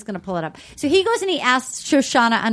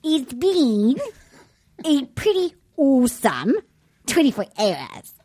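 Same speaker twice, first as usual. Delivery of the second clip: amateur, overdone New Zealand accent; attempted New Zealand accent; 3 semitones higher than usual. Shown as a demonstration. Host copies Jordan from New Zealand, attempted Kiwi accent. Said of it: "a New Zealand accent with me".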